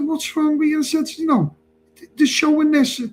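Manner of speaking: retold high-pitched exasperation; incredulous retort. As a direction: fire it back high and exasperated. Retold heated argument.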